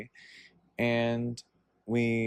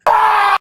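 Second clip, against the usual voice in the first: very loud, high "Ah!" imitating a jump scare; sudden scare yell; 15 dB louder than usual. Man jumping at her in dark elevator, sudden loud yell. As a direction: burst out with a piercing startle shout. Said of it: "he looks at me and goes".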